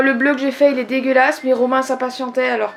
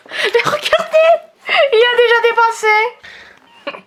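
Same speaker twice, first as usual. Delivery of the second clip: high, slow, through laughter; laughing; 11 semitones higher, 4 dB louder, slower than usual. Real, not acted. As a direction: laugh while you point it out. Laughing at the painting.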